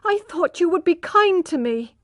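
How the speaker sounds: high, hurt plea near tears; high, tearful reproach. A narrator's reading. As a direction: reproach him through tears. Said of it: "her eyes filling with tears".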